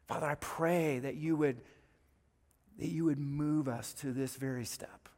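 gentle pastoral prayer. Acted. Closing prayer.

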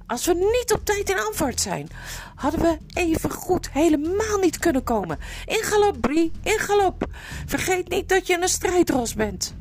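high-pitched, insistent exhortation; urgent calling out. A narrator's reading. Arevis orders Brie to gallop, urgent call. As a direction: drive the horse on, voice held high. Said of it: "riep Arevis".

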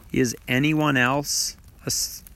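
usual speaking voice

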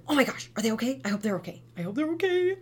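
high, worried gasping; mock panicked mom. Shown as a demonstration. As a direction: act out the panicked parent fretting over the fall. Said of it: "To, like, gasp and".

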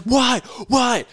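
high, loud startled questions; alarmed shouting. Retold or imitated. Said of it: "He's like".

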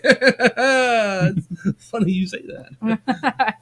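high, slow, laughed-out retort; laughing reply. Retold or imitated. Tired man answering his coworker's jab, laughing. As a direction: drag it out through high, loud laughter. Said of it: "I was like".

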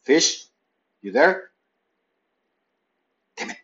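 ordinary delivery

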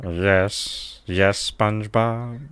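slow, nasal-free mock Squidward drawl; lightly doing Squidward, slow and soft; Squidward impression. Friends joking about cartoon voices, a slow mock Squidward. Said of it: "I seriously can't do Squidward".

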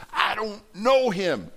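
high, drawn-out angry denial; mock scream. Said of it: "he's screaming, he's cursing, he's swearing".

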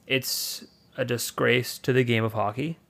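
ordinary talk, nothing marked

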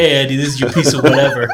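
fast, laughing, hammed-up Minnesota accent; bad Minnesota accent. Boss handing a designer a task, bad Minnesota accent. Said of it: "It's a bad accent".

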